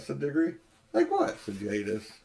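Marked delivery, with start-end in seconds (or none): 0.9-1.4 sharply rising puzzled question; high, surprised queen. A narrator's reading.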